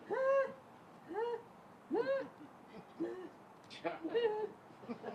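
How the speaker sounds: high, breathless tearless sobs; mock sob with no tears left; retold dry crying on the floor; dry sobbing. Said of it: "I was".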